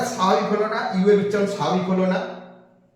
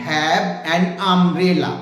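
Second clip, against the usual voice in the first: an stressed and drawn out, the whole line very slow; a teacher slowly modelling the sentence; slow model sentence; much slower than usual. Shown as a demonstration. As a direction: stretch every word, lean on an. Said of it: "যেমন আমি বললাম".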